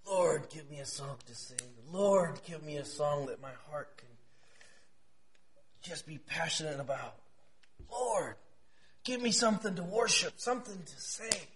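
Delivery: soft, hushed plea; low-volume intimate prayer; silent prayer. Retold or imitated. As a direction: pray it quietly, as if privately. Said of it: "praying silently".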